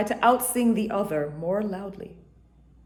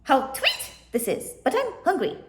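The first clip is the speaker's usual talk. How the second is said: much higher parakeet squeal; shrieking parakeet; 8 semitones higher than usual. A narrator's reading. Indian parakeet complaining of hunger, high squeal. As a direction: screech it like a hungry bird. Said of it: "shrieked out".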